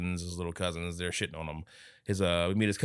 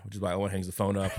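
ordinary talk

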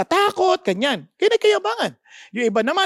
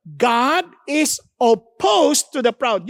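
very slow reading; much slower than usual. Acted. Rereading verse, slowed.

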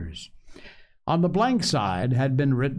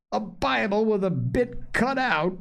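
high-pitched mocking echo; derisive; 9 semitones higher than usual. A narrator's reading. Silver scoffs at Dick's Bible, derisive. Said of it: "returned Silver derisively".

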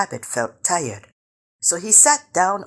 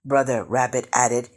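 plain reading voice

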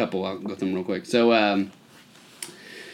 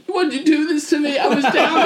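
high wailing complaint, getting louder; mock tearful child; 13 semitones higher, 3 dB louder than usual. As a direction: whine at mom like a kid in tears. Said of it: "I was, like, in tears".

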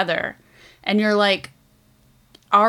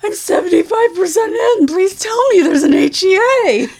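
much higher and louder, pleading; desperate pleading; 11 semitones higher, 8 dB louder than usual. Retold or imitated. Voice-messaging a friend mid-book, high desperate plea. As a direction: beg like your heart is on the line. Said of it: "I was sending her voice messages".

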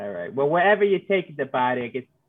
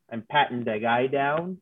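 usual speaking voice

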